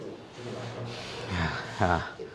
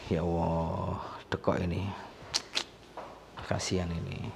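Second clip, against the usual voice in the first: hushed aside; 7 semitones lower, 15 dB quieter than usual. Real, not acted. Murmured aside over injury.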